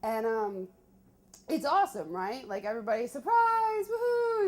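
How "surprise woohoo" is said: imitating a much higher, louder cheer; crowd cheer. Friends cheering at the surprise party, loud and high.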